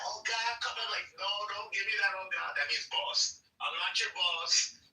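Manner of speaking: usual speaking voice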